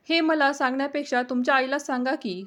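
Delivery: plain reading voice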